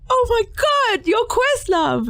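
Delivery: high, far, slowed delight; starstruck squeal. Retold or imitated. On stage, telling the drummer she's starstruck with a high squeal. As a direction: gush like a starstruck fan. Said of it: "looking around at the band like".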